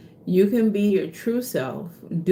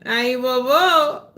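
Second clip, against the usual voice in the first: exclaimed invocation; 6 semitones higher, 5 dB louder, slower than usual. Real, not acted. Vodou invocation with excitement.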